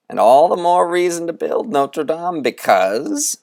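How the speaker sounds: Moundstrout preaching. Acted. Conjurer rousing the boys to build a cathedral, grandly. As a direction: build it up like a fiery sermon on cathedrals.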